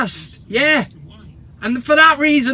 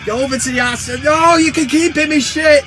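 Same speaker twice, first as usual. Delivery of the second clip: loud, high mock terrace shouting; hammed-up fan squabble; bickering football fans; 5 semitones higher, 5 dB louder than usual. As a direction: shout across the stands as fed-up supporters. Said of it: "In between the Man United fans".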